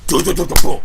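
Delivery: loud repeated do do do thuds; mock sound of a friend falling into a hole; imitated thudding fall. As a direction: blurt out the tumble noise. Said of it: "all I heard is, like, a big".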